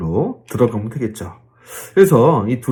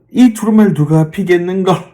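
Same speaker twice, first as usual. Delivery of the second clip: higher, projected proclamation; angel crying out; 5 semitones higher, 4 dB louder than usual. Retold or imitated. Preacher acts out the angel seeking an opener, crying out. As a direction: call it out like a herald angel. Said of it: "막 이렇게 외쳤다는 거예요".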